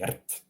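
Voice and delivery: soft "rt", trill then T; syllabic rolled R. Shown as a demonstration. Teacher spelling out R T, soft vowelless trilled "rt". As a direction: roll "rt" softly with no vowel. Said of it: "Just R T".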